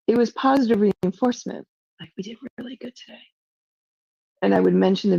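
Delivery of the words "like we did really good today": much softer and quicker under the breath; softer, fast repeat. Real, not acted. Repeating praise to Lauren under her breath, softer and fast. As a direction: toss it off softly and fast.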